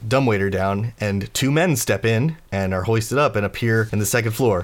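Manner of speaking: ordinary talk, nothing marked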